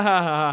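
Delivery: loud mock "ha ha ha"; imitated laughter. Both friends laugh at each other, imitated laughter. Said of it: "they both laugh at each other".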